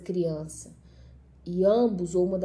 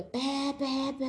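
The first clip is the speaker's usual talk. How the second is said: high-pitched repeated buzzer noise; frantic doorbell imitation; 5 semitones higher, 4 dB quieter, much slower than usual. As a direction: mimic a doorbell going crazy. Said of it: "a campainha fica doida".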